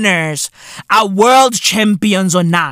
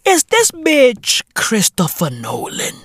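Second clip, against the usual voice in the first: ordinary talk, nothing marked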